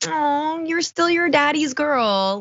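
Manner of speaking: put-on high cooing voice, teasing like to a child; sing-song mock tenderness; cooing mock baby talk. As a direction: coo at her like a doting parent. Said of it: "it's just kind of adorable 'cause it's like".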